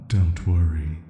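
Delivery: ordinary delivery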